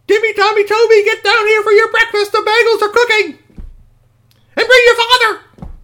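very high, loud call up the stairs; calling upstairs. Acted. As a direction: shout for the kids to come down. Mom calling kids downstairs.

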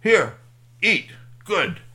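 loud, choppy single words; translating device. Acted. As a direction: bark it out word by word like a crude translator. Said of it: "translated it to something like".

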